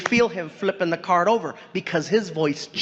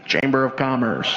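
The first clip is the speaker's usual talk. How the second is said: much lower, formal announcer voice; deep disguised voice; 10 semitones lower than usual. Retold or imitated. Comedian fooling G at the door, deep disguised voice. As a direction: drop the voice way down to fake an official.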